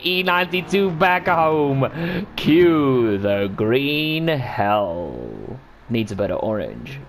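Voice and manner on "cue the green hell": ordinary delivery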